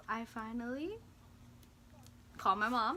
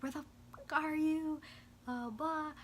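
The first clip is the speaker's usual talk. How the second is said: higher-pitched imitation of a pissed mom; mock yelling; 7 semitones higher than usual. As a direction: scold like an angry mother, without a full yell. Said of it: "yelling at me. She was pissed".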